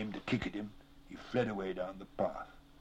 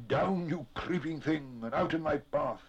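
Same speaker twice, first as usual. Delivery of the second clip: high, drawn-out order to a foe; slow, raised-pitch rebuke; gasped command; 6 semitones higher, 4 dB louder than usual. A narrator's reading. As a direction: order them down with force. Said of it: "he gasped".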